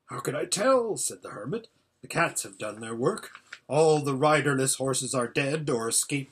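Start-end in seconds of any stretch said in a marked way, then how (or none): 0.1-1.0 higher, impatient retort; exasperated reply. A narrator's reading. Hermit answers anxious listeners, exasperated reply. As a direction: throw the question back, pitched up.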